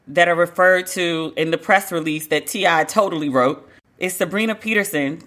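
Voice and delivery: normal talking voice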